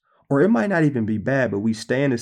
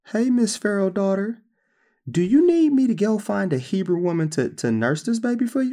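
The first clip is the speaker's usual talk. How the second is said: put-on higher, eager little-girl voice; 10 semitones higher than usual. Moses' sister offering to find a nurse, eager and high. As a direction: pitch it up like a keen young girl. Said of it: "Moses' sister jumped back into the picture like".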